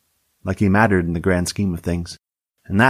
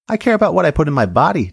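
higher-pitched, wounded protest; pained indignation; 9 semitones higher, 4 dB louder than usual. A narrator's reading. Deputy defends her diet to the sheriff, pained indignation. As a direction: protest as if being questioned hurts. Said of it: "as if it hurt physically to be questioned".

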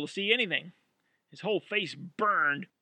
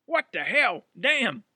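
pained outburst; 7 semitones higher, 9 dB louder, slower than usual. Acted. Roy after the spraying.

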